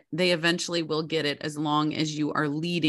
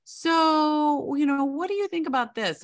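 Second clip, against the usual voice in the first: much higher, playful kid voice imitating her child; imitating a late-night kid; 10 semitones higher than usual. A parent mimics her child stalling bedtime with a question, in a high kid voice. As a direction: mimic a wheedling kid who wants to talk. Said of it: "They're like".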